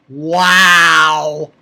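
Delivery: loud, long amazed wow; big awed wow. Real, not acted. Reviewer stunned by the proposal, loud awed wow. Amazed reaction, repeated louder.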